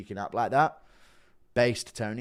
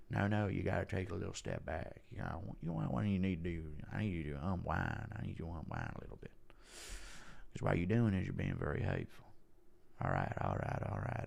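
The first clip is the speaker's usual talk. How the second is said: soft, low Texan actor impression; Matthew McConaughey; 4 semitones lower, 9 dB quieter than usual. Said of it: "Impression of Ngannou and McConaughey".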